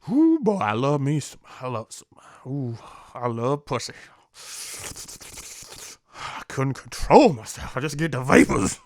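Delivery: a mild mock cad swooning over women; hammed-up lustful guy. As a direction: ham up a guy who can't control his lust. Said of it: "this is how I would say it".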